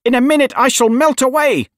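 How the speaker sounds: very high, loud witch's shriek; panicked high-pitched scream. A narrator's reading. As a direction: wail it out at the top of the voice. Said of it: "she screamed".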